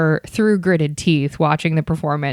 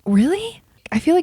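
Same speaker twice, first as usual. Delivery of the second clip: surprised; 11 semitones higher than usual. Real, not acted. Sister denies being in play.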